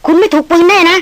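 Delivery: frantic check that she was not hit; anxious shout. Acted. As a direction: stretch each word out, desperate to know. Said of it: "ตะโกนออกมาลั่นป่าด้วยความดีใจ".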